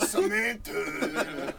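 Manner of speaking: very slow, stretched-out Tony Danza call through laughter. Retold or imitated. Calling Samantha as Tony Danza, slow laughing impression. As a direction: drag the name out like Tony Danza. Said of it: "The Tony Danza".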